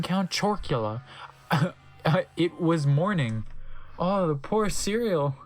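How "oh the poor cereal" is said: ordinary talk, nothing marked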